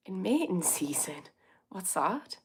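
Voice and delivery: softly puzzled echo. Real, not acted. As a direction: repeat it quietly, baffled by the question. Puzzled by a viewer's odd question.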